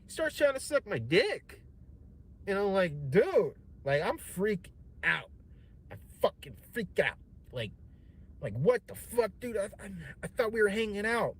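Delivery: high, loud panicked yelling; freaking out. Retold or imitated. Young man freaking out at the host, yelling. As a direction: shout it out in shock.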